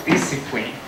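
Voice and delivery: usual speaking voice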